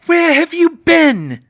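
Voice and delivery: high, very loud, slow mad witch; hissed demand. Acted. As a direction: hiss the question. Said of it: "she hissed".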